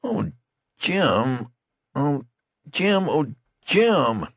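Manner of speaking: high, loud grieving cries; lamenting. A narrator's reading. Youth laments over his dying friend. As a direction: wail his name in grief. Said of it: "The youth still lamented".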